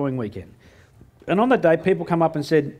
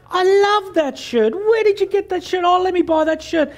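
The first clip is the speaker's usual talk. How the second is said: much higher, slow, swooning over the shirt; gushing customer; 14 semitones higher, 4 dB louder, slower than usual. Retold or imitated. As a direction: gush like a smitten shopper. Voicing an eager customer.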